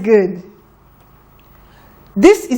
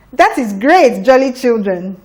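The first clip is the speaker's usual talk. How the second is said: usual speaking voice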